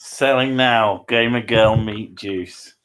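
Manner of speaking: slow, loud advert announcement; mock sales pitch. Man pretending to sell gamer girl meat juice, announcer style. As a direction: announce it slowly like a product launch.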